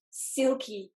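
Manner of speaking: usual speaking voice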